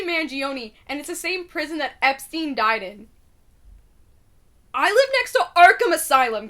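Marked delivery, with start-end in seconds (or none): 4.7-6.5 loud mock alarm. Real, not acted. Alarmed joke about the prison.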